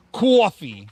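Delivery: "Coffee" in a put-on New York accent, loud on the first vowel. Shown as a demonstration. Streamer shows chat how New Yorkers say coffee, New York accent. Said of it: "Saying coffee in New York accent".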